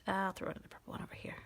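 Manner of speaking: fast murmured whisper. Real, not acted. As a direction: whisper it under your breath. Whispered aside while coloring.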